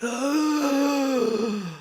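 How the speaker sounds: high, drawn-out gasping cry; awestruck gasp. Retold or imitated. Man retelling hearing a voice in prayer, overwhelmed gasp. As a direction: suck in a long, stunned gasp.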